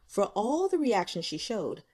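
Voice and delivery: plain reading voice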